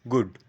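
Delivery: গুড with a short, quick vowel; short GOOD. Shown as a demonstration. Teacher demonstrating for learners, short গুড. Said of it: "short করে দেবো".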